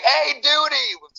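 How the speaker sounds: high, slow call through a mic; drawn-out hail over the headset. Retold or imitated. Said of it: "[name]'s like".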